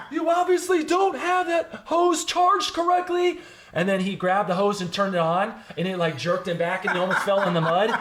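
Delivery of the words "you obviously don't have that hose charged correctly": high, slow scolding shout; captain's angry yell. Retold or imitated. Captain shouting at the recruit about the hose. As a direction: roar it like a furious captain. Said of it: "He goes".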